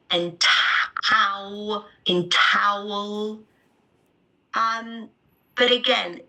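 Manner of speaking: ordinary talk, nothing marked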